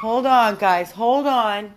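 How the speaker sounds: loud call to the dogs. Real, not acted. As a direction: shout over the barking. Calling over barking dogs.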